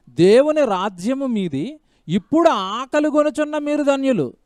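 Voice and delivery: proclaimed verse. Acted. Preacher proclaiming the Beatitudes aloud to the congregation. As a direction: declare the promise to the church.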